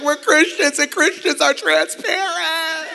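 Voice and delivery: high, drawn-out tearful wail; hammed-up sobbing through the words; mock crying. Two men crying in a booth explain themselves to onlookers, mock-tearful. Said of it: "two guys crying in a booth".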